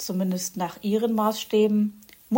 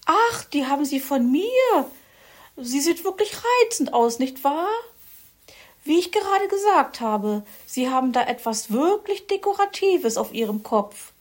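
haughty Frau Elster; 7 semitones higher than usual. A narrator's reading. Frau Elster mocks Herr Fuchs's cap, high snooty voice. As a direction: look down your beak at him.

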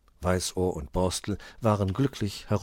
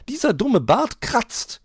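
very high, slow mimicking of his whine, rising on "dumme"; mocking quote; 9 dB louder, slower than usual. A narrator's reading. Frau Elster mocks the fox's complaint, high and slow. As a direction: parrot his complaint back at him. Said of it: "noch nie haben wir ihn sagen hören".

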